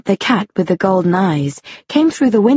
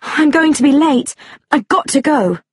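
raised, hurried dismay; flustered cry; 6 semitones higher than usual. A narrator's reading. Woman late for a meeting, flustered. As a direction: fret aloud about being late. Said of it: "she cried".

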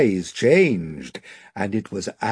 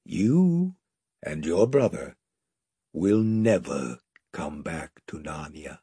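very gently; 9 semitones lower, 5 dB quieter than usual. A narrator's reading. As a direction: break the news tenderly. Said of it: "said Aslan very gently".

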